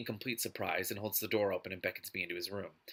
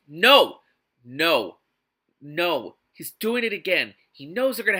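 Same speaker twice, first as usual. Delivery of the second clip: sharp, louder cries of no; loud protest; 5 semitones higher, 12 dB louder, slower than usual. Real, not acted. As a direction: shout it in exasperated refusal.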